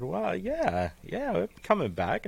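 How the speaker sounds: high-pitched surprised reply, retold; taken by surprise. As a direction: answer as if caught off guard. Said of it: "it kinda took me by surprise".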